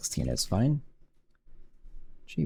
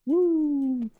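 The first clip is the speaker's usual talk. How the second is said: sudden high cheer; a joyful whoop; excited woo. Real, not acted. Celebrating the game working.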